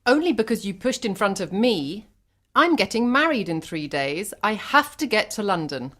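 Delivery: put-on high-pitched indignant Irish girl; heated protest. Irish girl shouts at blonde woman over car, indignant. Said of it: "shouted an Irish girl".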